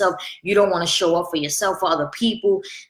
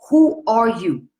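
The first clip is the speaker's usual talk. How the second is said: each word pressed out slowly, louder; slow emphatic question; 4 semitones higher, 5 dB louder, slower than usual. Real, not acted. To her viewers, asking who they are in hard times, slow and emphatic. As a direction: challenge them, who are YOU. Rhetorical challenge to listeners.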